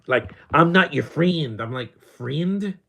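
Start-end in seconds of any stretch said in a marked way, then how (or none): 0.5-1.6 higher, louder, drawn-out Kiwi parody; mock Kiwi accent. Streamer mimicking Kiwi voices he finds ridiculous, mock Kiwi accent. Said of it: "I heard these Kiwi accents". 2.0-2.8 "Friend" said as "freend", pitched up in disbelief; mimicked Kiwi vowel. Streamer querying the Kiwi accent, "Friend" mockingly said "freend". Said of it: "not friend".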